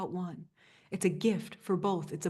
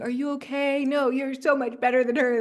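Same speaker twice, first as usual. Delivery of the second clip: put-on higher, eager teen reassurance; teenage friend; 6 semitones higher, 6 dB louder than usual. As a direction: comfort her like an excitable teenager would. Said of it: "you know how friends who are teenagers talk like that".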